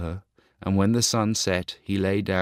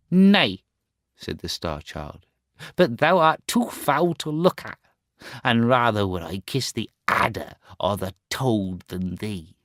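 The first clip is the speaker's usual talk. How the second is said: put-on scornful boy; 2 semitones higher than usual. Boy refusing to kiss his beggar mother, scornful. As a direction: spit out the refusal with disgust. Star Child's voice.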